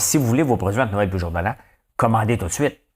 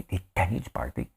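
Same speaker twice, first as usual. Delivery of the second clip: ordinary talk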